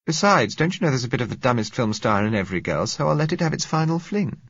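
high, lively woman's character voice; playful Josella, high-voiced. A narrator's reading. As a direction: speak lightly as a woman indulging a whim.

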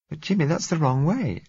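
high, worried woman's voice; anxious protest; frightened woman. A narrator's reading.

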